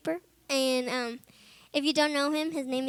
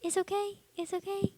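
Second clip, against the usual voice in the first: much higher and softer, gentle repeats; soothing friend; 7 semitones higher, 5 dB quieter than usual. Retold or imitated. Friend holding her as she sobs, softly reassuring. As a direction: hush her like an older brother would. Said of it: "he was like".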